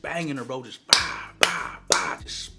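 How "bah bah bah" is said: imitated blows. Imitating the hitting sounds.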